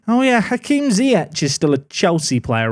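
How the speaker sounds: ordinary talk, nothing marked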